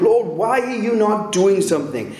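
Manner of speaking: high, pained plea to God; anguished cry. Retold or imitated. A believer crying out to God in anguish over long delay. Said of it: "anguish in it".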